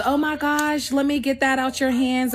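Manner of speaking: mock helpful voice. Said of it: "first thing people say".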